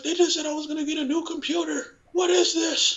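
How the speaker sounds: very high, put-on dismayed voice; upset employee imitation. Fired worker promised a new computer, high mock dismay. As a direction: whine it high in mock bewilderment.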